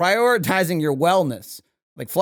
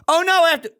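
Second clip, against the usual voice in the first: mock panic; 12 semitones higher, 5 dB louder, much faster than usual. Imagined user reaction.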